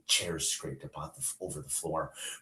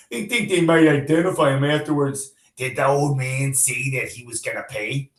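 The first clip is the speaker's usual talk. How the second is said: a cop talking tough, louder; gruff cop; 11 semitones higher, 16 dB louder than usual. Acted. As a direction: swagger through the gossip like a street cop. Reads the cops' banter.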